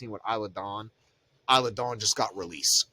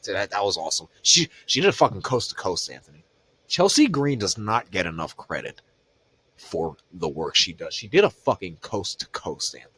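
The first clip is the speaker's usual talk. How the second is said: ordinary delivery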